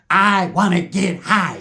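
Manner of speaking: dreamy slow mock stoner; slow stoned drawl. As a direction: stretch it out very slowly. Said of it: "he said".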